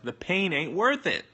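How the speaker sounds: high, loud exaggerated objection; mock protest. Mock protest in quoted reply.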